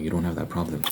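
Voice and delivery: usual speaking voice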